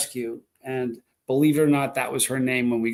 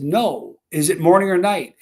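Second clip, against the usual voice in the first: louder, higher pressed question; retold insistence; 3 semitones higher, 6 dB louder than usual. Groggy napper pressing his laughing partner, retold insistence. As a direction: re-enact pressing for a straight answer.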